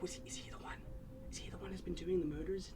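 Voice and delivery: hushed gossip, breathy and quick; whispering townsfolk. Acted. Townsfolk gossiping about the prisoner, whispered. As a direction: whisper the rumor so nobody hears.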